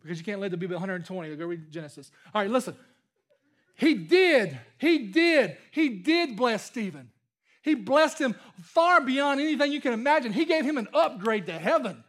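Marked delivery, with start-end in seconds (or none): 3.8-7.1 "did" punched hard each time, with pauses between; emphatic stress on "did". Real, not acted. Preacher answering why God didn't bless Stephen, "did" hit emphatically.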